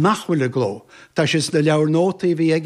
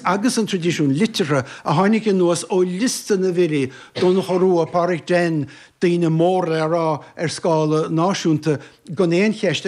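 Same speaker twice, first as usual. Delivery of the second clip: ordinary delivery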